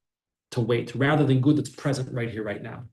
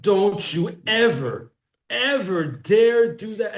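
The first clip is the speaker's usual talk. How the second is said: mock scream; 6 semitones higher, 3 dB louder, slower than usual. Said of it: "You scream at the child".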